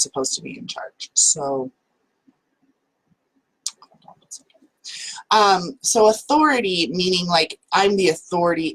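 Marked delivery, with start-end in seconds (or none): 3.8-4.7 whispered aside. Real, not acted. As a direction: whisper it so the call can't hear. Aside to kid coming upstairs.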